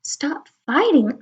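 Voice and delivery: ordinary delivery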